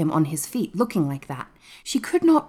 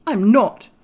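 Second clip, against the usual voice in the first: loud, firm, higher denial; emphatic refusal; decisive; 6 semitones higher, 8 dB louder than usual. A narrator's reading. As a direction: state it with total decision. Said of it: "he said it with such decision".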